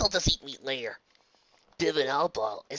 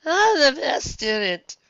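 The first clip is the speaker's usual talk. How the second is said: dazed slurring, slow; slurred; 14 semitones higher, 9 dB louder, much slower than usual. Acted. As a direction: mumble it dizzily. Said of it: "said in a slurred voice".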